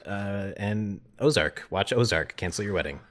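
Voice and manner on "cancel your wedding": normal talking voice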